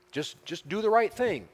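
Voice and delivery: high-pitched, urging plea; pleading. Acted. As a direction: plead with someone to repent. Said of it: "God is pleading with Cain".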